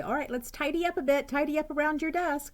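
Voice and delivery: high, cheerful classroom tidy-up call; sing-song teacher. Retold or imitated. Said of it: "I always say".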